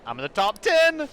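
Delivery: loud, thrilled announcement; excited shout. Acted. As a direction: yell it like you just won. Reading game line aloud.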